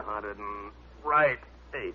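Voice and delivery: slow, effortful count; straining through push-ups. Acted. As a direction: drag the number out as the arms push. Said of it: "Seymour did his push-ups".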